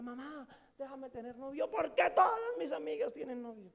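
imitando a una niña que pide, más alta; súplica de niña caprichosa; niña suplicando. Retold or imitated. (una niña le ruega a su mamá, con voz aguda)

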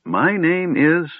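high, slow, searching for the name; puzzled pause. A narrator's reading. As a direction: drag it out as you try to remember. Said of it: "his brow furrowed".

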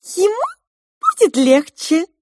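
high, loud squeaky little mouse voice; pink mouse. A narrator's reading. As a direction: pipe up like a tiny mouse. Розовая мышь продолжает. (высокий голос мышки)

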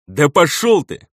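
loud, drawn-out angry retort; almost snarl it back at him; near growl. A narrator's reading. Elder snaps back at the steward, almost growling. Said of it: "чуть ли не прорычал в ответ Гарлан".

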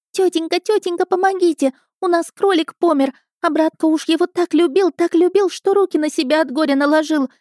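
put-on high-pitched whiny boy begging for help; put-on wailing child voice; gabbling boy in tears. A narrator's reading. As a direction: plead shrilly like an upset little lad. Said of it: "зачастил он".

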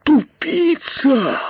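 very high, drawn-out disbelief; incredulous outrage. A narrator's reading. Great python repeats the insult, incredulous. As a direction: squawk it slowly, unable to believe it. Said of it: "не веря своим ушам".

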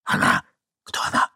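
tense voiceless questioning; acted young man's whisper; urgent whisper. A narrator's reading. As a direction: whisper a demand to know. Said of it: "прошептал молодой человек".

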